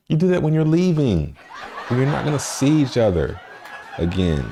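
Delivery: cracking up mid-rant; laughing. Real, not acted.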